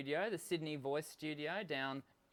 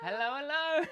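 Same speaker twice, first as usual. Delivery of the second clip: playful sing-song greeting; 9 semitones higher, 6 dB louder than usual. Acted. Echoing guest's greeting playfully.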